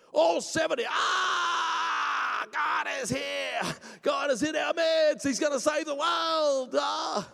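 high, slow, wailing shout; ecstatic prophesying. Acted. Seventy elders prophesy together, crying out in exaggerated ecstasy. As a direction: proclaim it in hammed-up rapture.

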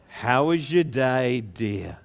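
slow, low, drawn-out question; wife's greeting. Acted. Wife to shepherd home from work, slow drawn-out greeting. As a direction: stretch it out as a doting spouse. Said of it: "they go home to their wife".

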